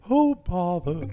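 high, loud put-on character voice; attempted character impression. Host attempts a failed impression for his co-host, high cartoon voice. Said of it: "a man of very little impressions".

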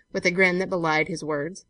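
usual speaking voice

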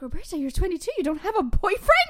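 high, loud incredulous mother; a shocked mom scolding her daughter; mom's disbelief. Retold or imitated. Roberta quoting her mom's shocked question, high and loud. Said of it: "she'd be like".

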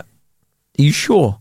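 slow, unimpressed dog voice; drawn-out skeptical dog; the dog doubting the snow walk, slowed right down; doubtful dog. Retold or imitated. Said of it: "she was like".

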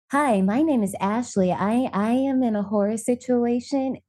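proper customer-service voice; code-switched phone voice. Retold or imitated. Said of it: "he code switched hella quick".

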